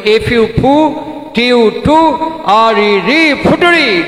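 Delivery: mock schoolboy drilling of ফুটুরি, chunk by chunk; imitated memorising aloud, breaking ফুটুরি into syllables; loud rote recitation. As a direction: chant the spelling of ফুটুরি with each syllable stretched. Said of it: "বলে চিৎকার করে মুখস্থ করছে".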